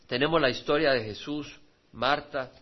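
usual speaking voice

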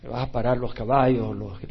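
ordinary talk, nothing marked

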